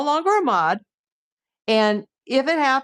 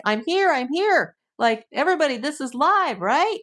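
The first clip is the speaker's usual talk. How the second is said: mimicked commenters, pitched up; confused viewers; 5 semitones higher than usual. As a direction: play a puzzled viewer seeking attention.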